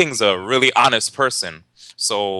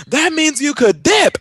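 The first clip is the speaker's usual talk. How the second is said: high, loud, slow sing-song taunt; gleeful taunt repeated; 4 dB louder, slower than usual. Retold or imitated. G Money repeating the brother's line, high sing-song taunt. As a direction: drag out the punchline gleefully, very high. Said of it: "all G Money keeps saying is".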